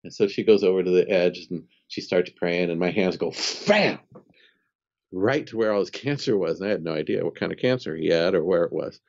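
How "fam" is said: sound effect. Retold or imitated. Hands suddenly move, imitated sound.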